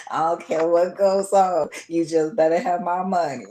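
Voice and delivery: loud, laughing imitation of dad; loud mock dad voice; dad's voice. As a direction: belt out dad's catchphrase, laughing. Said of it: "that was playing in my head".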